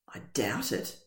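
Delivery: low, dull and quiet; glum. Acted. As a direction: drop the voice, deflated. Gloomy reply about his dad.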